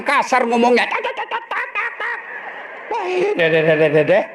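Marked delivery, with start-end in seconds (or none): 0.9-2.2 high, quiet rapid tak-tak chatter; imitating the wife's rapid nagging; nagging chatter imitation. 2.9-4.2 resigned yes-yes. Acted. Husband giving in.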